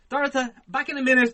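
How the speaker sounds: loud, high, hurried-off shout; calling out. Retold or imitated. Man calls to Dorothy as he rushes downstairs, calling out loudly. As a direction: holler it to someone as you dash off. Calling to partner while rushing out.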